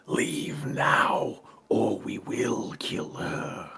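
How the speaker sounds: breathy, hissed threat, drawn out; slow, whispery hissing menace; menacing hiss. Acted. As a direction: hiss it slowly like a villain's warning. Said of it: "he hisses and says".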